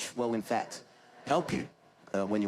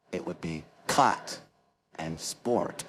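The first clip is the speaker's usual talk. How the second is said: slow American take, "sport" with a hard R; American vowel in "cut", growled R in "sport"; American accent; 2 semitones lower, slower than usual. Shown as a demonstration. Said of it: "In America, those two words would be almost completely different".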